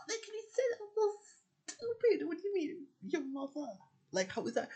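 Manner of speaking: squeaky high outraged disbelief; high mock-indignant voice. Telling about father's insult, squeaky high mock disbelief. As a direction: mimic her own baffled outrage, way up high.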